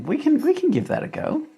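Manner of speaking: "we can give that a go" in a very high, put-on squeak; silly high voice. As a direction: play a cartoonish character agreeing. Playful reply to viewer suggestion.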